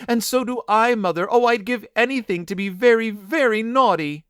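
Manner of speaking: put-on high, plaintive child's voice; long for it in a high lament; sad crying. A narrator's reading. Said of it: "cried sadly".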